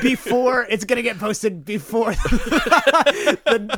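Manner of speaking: laughing through the words; slow, high giggly delivery. Real, not acted.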